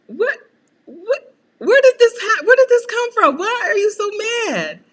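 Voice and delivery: high, loud, baffled protest; mock baffled daughter. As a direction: shout it high at an angry mom. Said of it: "I'm like".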